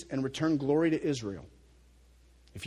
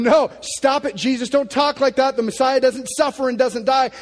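protesting Peter; 10 semitones higher, 12 dB louder than usual. Retold or imitated.

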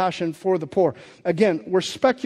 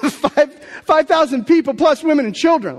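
higher and louder with a laugh; excited disbelief; 8 semitones higher, 7 dB louder than usual. Real, not acted. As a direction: marvel at the crowd size.

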